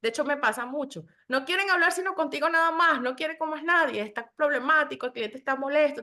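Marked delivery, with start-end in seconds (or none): 1.3-6.0 urgent colleagues quote. Retold or imitated.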